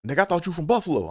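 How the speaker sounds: high, fast, incredulous imitation of a friend; mock disbelief. Friend doubting he's from Maryland, high fast mock disbelief. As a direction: toss it off quick and high like you can't believe it.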